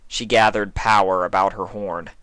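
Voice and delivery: plain reading voice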